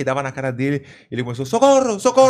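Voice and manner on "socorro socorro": high, loud mock cry for help in a Spanish accent. Spanish sparring partner starts begging for help, mock Spanish-accented cry. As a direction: imitate a Spaniard yelping for help. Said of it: "porque o espanhol é "socorro".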